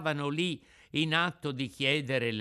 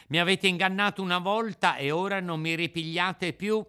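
put-on raised, loud puppet-boy voice; defiant puppet; 7 semitones higher, 5 dB louder than usual. Puppet rebuffs those who cheated him, defiant. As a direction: throw the accusation back at them. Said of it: "rispose il burattino".

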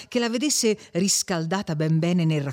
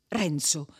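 plain reading voice

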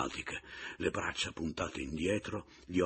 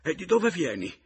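high, loud frightened question; terrified; 12 semitones higher, 9 dB louder than usual. A narrator's reading. Widow questioning the man back from the dead, terrified. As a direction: cry out the question in terror. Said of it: "mi chiese con terrore".

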